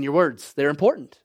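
ordinary talk, nothing marked

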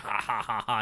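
spaced-out fake "ha ha ha"; mock villain laugh. Uzas gloating over the dead dog, fake laughing. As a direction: put on a deliberate, separate-beat laugh.

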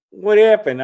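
high, loud retold demand; retold alarmed question. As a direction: imitate an alarmed dad asking. Father questions son, retold.

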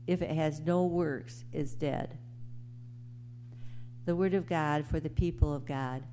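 ordinary talk, nothing marked

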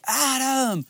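high sing-out of a name; mum yelling out. Retold or imitated. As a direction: imitate a mum calling her boy in from afar. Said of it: "she'd be yelling out".

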